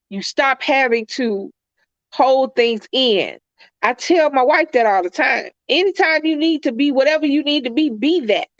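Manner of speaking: ordinary talk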